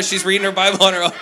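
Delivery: excited burst. Real, not acted. Excited boast about daughter.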